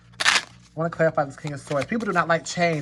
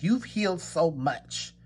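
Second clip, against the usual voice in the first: slow, weighted "You've healed so much"; emphasis; 3 dB quieter, slower than usual. Shown as a demonstration. Tarot reader to her royal court, stressing the healing slowly. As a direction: lean on "You've" and draw it out. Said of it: "I wanna put some emphasis on that".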